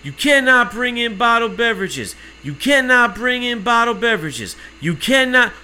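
high, loud looping announcement; mimicked guard chanting the rule; nonstop announcer agent. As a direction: repeat the rule mechanically, no break between. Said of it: "no pause".

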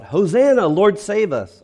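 high, loud crowd acclamation; shouted Hosanna. Retold or imitated. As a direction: cry it out like a cheering crowd.

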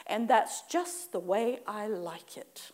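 slow, breathy whisper; whispered tag line. Shown as a demonstration. Preacher showing the congregation a whispered tag line. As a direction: let it out as a sly aside. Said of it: "you also tag this on".

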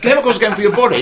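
usual speaking voice

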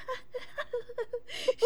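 high baby-like mock giggle; imitated high giggle. Mocking Carrie giggling like a baby.

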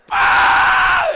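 long, very high shriek; piercing mock tantrum wail; toddler tantrum scream. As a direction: imitate a screaming toddler full out.

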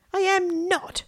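very high, indignant protest without volume; mock shout. A narrator's reading. Stephen protesting at his sister's teasing, indignant mock shout. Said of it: "shouted Stephen".

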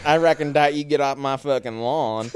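a hammed-up Southern yokel guarding his lawn; mock Southern accent. Friend riffing on a word, mock Southern yell. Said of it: "that's the accent driving".

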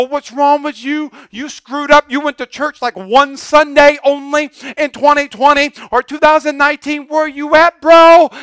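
high, hammed-up telling-off; mock scolding. God as he never speaks to believers, mock scolding. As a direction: pretend to berate a churchgoer who slipped up. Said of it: "God doesn't go".

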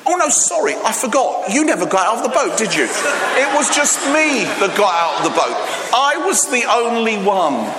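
high, fast gloating tease; gloating mock apology. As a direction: tease them, fake sorry. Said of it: "and said".

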